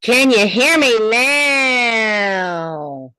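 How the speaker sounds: very loud, slow call to the back; loudness check. Shown as a demonstration. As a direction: project it out to the back row. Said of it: "act like you're talking to the people in the back".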